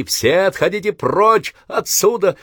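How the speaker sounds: high, slow snarling shout; frightened yell. Acted. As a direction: scream it through bared teeth. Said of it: "оскалившись, закричал".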